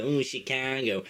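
slow, exaggerated northern Chicago accent; imitated Illinois Chicago accent. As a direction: lean on the Chicago vowels. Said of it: "northern accents, like the".

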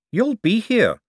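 high, weight on You'll; pressing young man. Acted. Young man pressing the forger to stay. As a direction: lean on You'll.

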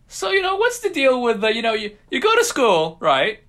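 Jerry Seinfeld. Shown as a demonstration. Doing a Seinfeld bit for the podcast, high comic voice. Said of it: "That's my Jerry Seinfeld impression".

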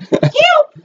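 high, mock cry for help; neighbor crying for help. Retelling the fallen neighbor's calls, a high mock cry for help. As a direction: cry out like a trapped old lady. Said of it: "all I hear is".

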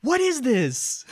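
very high, loud, drawn-out cry of disbelief; exasperated shout. Real, not acted. Reviewer baffled by the movie's scene, exasperated shout. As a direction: yell it slowly in total bafflement.

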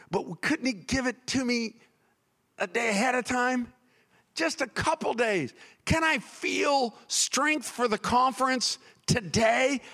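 high, hammed-up pleading; worked-up complaint. Tired preacher begging for strength ahead of time, mock-exasperated. Acts out own weary pleading.

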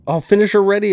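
high, loud game voice; mock game announcer. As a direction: call it out like a game. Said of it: "it said".

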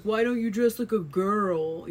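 mock schoolyard taunt; mocking schoolmates. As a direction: sneer it like a kid teasing a tomboy. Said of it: "they'd be like".

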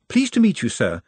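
eager, high-pitched polite greeting; polite Nutt. A narrator's reading. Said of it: "said Nutt, extending a hand".

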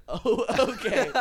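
high, loud, slow laughing oh okay; laughing mock reply. Podcaster retells a dumb commenter's reply, laughing high and loud. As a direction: laugh through the reply in disbelief. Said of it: "the other person goes".

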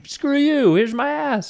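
high, loud jeering; mock taunt. Kids taunting another bus, high jeering yell. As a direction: yell it like a bratty kid. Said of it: "We'd be like".

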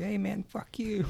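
high, thin, hushed mock outburst; retold cussing out. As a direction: imitate his cussing without volume. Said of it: "instantly started cussing me out".

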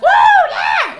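high loud whooping cheer; crowd whoop. Retold or imitated. Mimicking the church crowd with a loud whoop.

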